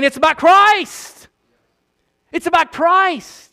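fervent sermon emphasis; slow preaching emphasis. Acted. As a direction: lean on every word from the pulpit. Preacher drives home the point.

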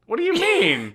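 high disbelieving question; incredulous. Real, not acted. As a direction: sound amazed, voice jumping up. Incredulous reply.

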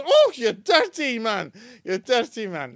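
loud, high, drawn-out Yorkshire scolding; milk the wife's outrage in broad Yorkshire; Yorkshire accent. Acted. Wife in a joke scolds a forward stranger, Yorkshire accent. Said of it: "the Yorkshire accent really makes it".

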